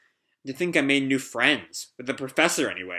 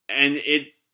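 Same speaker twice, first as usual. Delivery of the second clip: ordinary delivery, nothing marked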